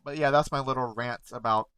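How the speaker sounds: ordinary delivery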